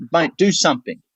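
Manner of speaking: high, insistent push to act; urging plea. Retold or imitated. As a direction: look up at him and urge him on. Urging Cam face to face.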